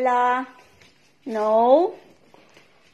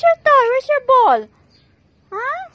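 high teasing; 10 semitones higher, 4 dB louder than usual. Real, not acted. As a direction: tease the dog in a high sing-song. Laughing, teasing dog.

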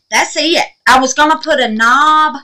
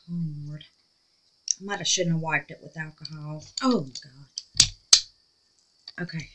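hushed; 6 semitones lower, 11 dB quieter than usual. Real, not acted. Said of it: "Y'all, I'm quiet, ain't I?".

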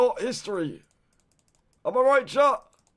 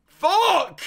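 sharp loud swear; angry yell; 9 semitones higher, 8 dB louder than usual. Real, not acted. Streamer reacting to a bad round, angry yelled swear. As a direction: blurt it out loud in frustration.

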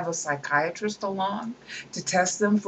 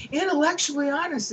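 much higher, sneering grandeur; mocking pompous quote; 9 semitones higher than usual. Lecturer ridiculing a scientist's boast, high and sneering.

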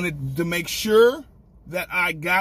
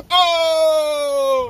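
excited shout; 12 semitones higher, 8 dB louder than usual. Real, not acted. Opening the donut box.

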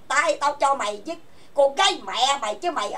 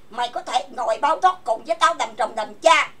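ordinary delivery

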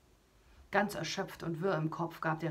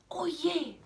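higher, louder startled cry; suddenly alarmed for the hero; 11 semitones higher, 6 dB louder than usual. Acted. Narrator reading to a child, startled as the dragon wakes.

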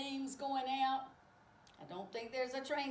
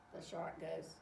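ordinary delivery, nothing marked

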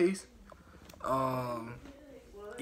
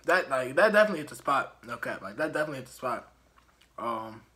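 chewy candy in mouth; sticky mouthful speech; talking while chewing; 2 semitones lower, faster than usual. Real, not acted. Seen 3: Lips pressed, jaw working between phrases; chewing also seen earlier.

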